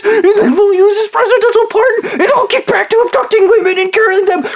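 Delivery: shrill, very high mocking voice with sobbing laughter; mocking Stu. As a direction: squeal out a cruel caricature, teetering between crying and laughing. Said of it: "I was making fun of Stu".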